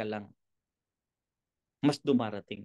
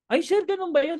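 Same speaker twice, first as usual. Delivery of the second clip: mock prospect voice; 10 semitones higher, 3 dB louder than usual. Prospect replying in seminar skit.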